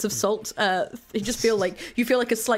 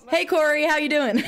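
higher-pitched generic American greeting; generic American accent; 4 semitones higher than usual. Acted. Sonia greeting Corey on the phone, generic American accent. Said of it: "a generic American".

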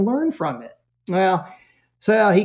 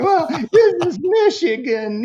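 put-on much higher, louder kid retort; 12 semitones higher, 4 dB louder than usual. Son reminding mom it is cold in Michigan, high kid retort. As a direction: pop it off like an indignant kid.

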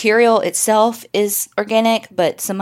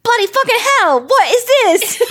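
mock British accent; 14 semitones higher, 4 dB louder than usual. Mimics British boys finding stones.

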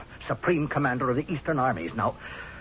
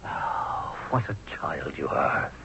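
lower, voiceless whisper of contempt; scornful whisper; 10 semitones lower, 3 dB quieter, slower than usual. Acted. General whispers his scorn at the queen's naivety. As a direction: breathe it out like pity.

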